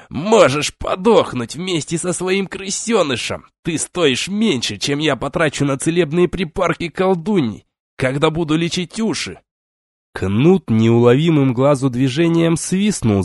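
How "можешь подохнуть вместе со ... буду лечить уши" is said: hateful overseer. A narrator's reading. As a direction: sneer down at the prisoner. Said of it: "с ненавистью".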